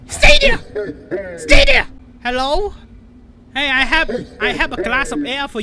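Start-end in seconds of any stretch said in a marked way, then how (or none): 0.1-1.9 high, loud squealed command; panicked shout. Real, not acted. Player holding Santa off, panicked high shouting. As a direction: shriek it to keep him back.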